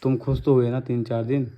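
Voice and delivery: ordinary talk, nothing marked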